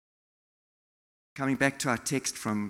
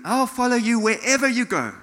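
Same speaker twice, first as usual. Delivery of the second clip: loud, high declaration of devotion; zealous eagerness; 8 semitones higher, 11 dB louder than usual. Acted. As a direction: pledge it like an over-keen volunteer. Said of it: "in excitement, in, in zeal".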